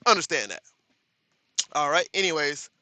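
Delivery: usual speaking voice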